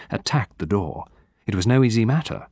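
ordinary delivery, nothing marked

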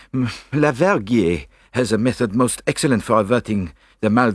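put-on thick French-accented fussy explanation; queasy Belgian detective. A narrator's reading. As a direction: wave off your friend, then teach your cure. Seasick Poirot explains breathing cure.

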